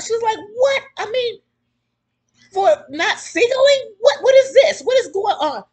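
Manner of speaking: high, loud, stunned disbelief; outraged, can't believe it, rising high; shocked disbelief. Retold or imitated. Woman's shocked disbelief retold.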